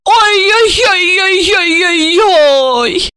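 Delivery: long, high mock wail. A narrator's reading. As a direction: coo "oy-oy" slowly in fake pity. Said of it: "усмехнулась Ольга".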